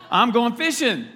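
I'm going fishing, shouted with glee; eager declaration. Shown as a demonstration. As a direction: announce it like the best plan ever. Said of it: "but I'm going fishing".

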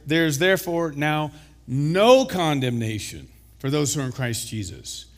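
NO stretched out strong before condemnation; stress on NO CONDEMNATION. Shown as a demonstration. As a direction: punch "no condemnation", then let the rest fall. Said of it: "Let's emphasize this".

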